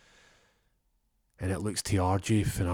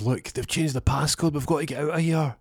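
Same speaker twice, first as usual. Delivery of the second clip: high, louder, fast alarm; panicked; 7 semitones higher, 5 dB louder than usual. Retold or imitated. Panicking about the passcode, high and fast. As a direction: rush it in a panic.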